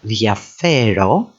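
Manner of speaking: diafero slowly as thia-FE-ro; diafero, slow, weight on FE; stress second from end. Shown as a demonstration. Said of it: "the second syllable from the end".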